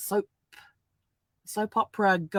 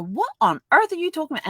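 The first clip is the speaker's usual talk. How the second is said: higher baffled question; puzzled husband; 10 semitones higher, 4 dB louder than usual. Retold or imitated. Husband baffled by her tabloid headline, higher. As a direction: act bewildered by her nonsense. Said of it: "he'd go".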